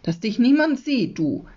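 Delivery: urgent hushed-up plea; urgent warning. A narrator's reading. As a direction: press the point hard on the boy. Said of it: "sagt er eindringlich".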